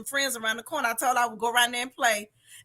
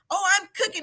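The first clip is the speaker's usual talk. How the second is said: higher-pitched mock thick Italian accent; 7 semitones higher, much faster than usual. Woman imitates the restaurant man, higher mock Italian accent. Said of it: "He had this real thick accent".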